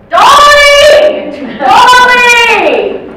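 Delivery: very high, very loud holler; shouting for Dolly. Acted. Resident shouts at the staff member for his wife, shouting. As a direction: bellow the name, long and high. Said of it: "Keep shouting for Dolly".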